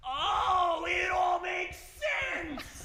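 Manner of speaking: high, loud, slow laughing cry; ecstatic realization. Acted. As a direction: shout the revelation with joy. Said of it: "Edna goes".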